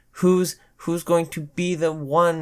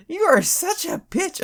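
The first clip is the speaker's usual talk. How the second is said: mock curse. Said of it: "cursing under my breath".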